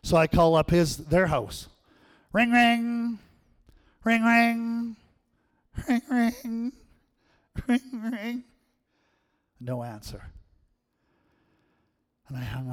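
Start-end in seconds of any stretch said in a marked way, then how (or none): 2.3-8.4 high, sing-song ringing phone noise. Retold or imitated. Calling Grandma, boy imitates the phone ringing high.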